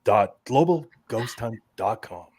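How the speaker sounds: slow, deliberate radio announcer. Pretend. Host repeats the website after hearing himself, radio voice. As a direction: draw out each letter like a station plug. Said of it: "that sounded very radio".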